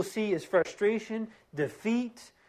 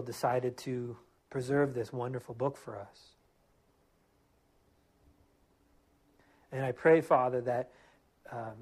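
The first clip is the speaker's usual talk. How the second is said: closing prayer; 3 semitones lower than usual. Acted. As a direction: pray humbly, lower and gentle. Pastor leads closing prayer.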